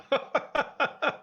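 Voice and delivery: high burst of laughter. Real, not acted. Laughing at joke.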